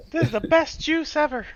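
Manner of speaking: very high mock-child squeal; delighted kid voice. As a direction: gush in a tiny high child's voice. Voices kid tasting the drink.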